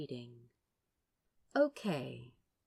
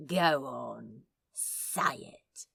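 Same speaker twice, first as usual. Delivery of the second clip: sly baiting; 5 semitones lower, much slower than usual. Acted. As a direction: coax him, low and sly. Crowley baiting, whispering.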